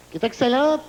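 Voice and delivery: usual speaking voice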